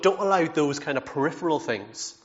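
normal talking voice

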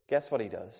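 ordinary talk, nothing marked

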